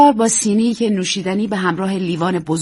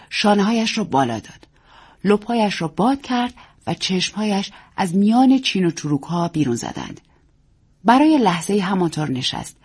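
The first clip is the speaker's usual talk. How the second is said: plain reading voice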